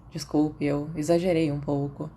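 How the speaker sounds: usual speaking voice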